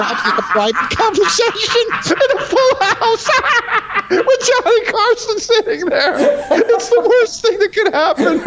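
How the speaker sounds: high, laughter-choked retelling; real cracking up mid-quote; laughing hard. Real, not acted. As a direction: squeeze the words out through helpless laughter. Cracking up while retelling the heckle.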